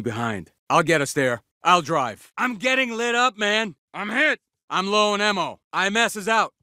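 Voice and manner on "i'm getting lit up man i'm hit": high, pressed cry under fire; urgent, raised-pitch battle distress; alarmed callout. Acted. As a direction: call out that you're in trouble. Soldier under fire calls out.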